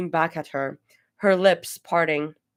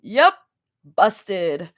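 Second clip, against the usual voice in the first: bright teasing; 4 dB louder than usual. A narrator's reading. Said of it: "Zoe's grin broke through. Bright".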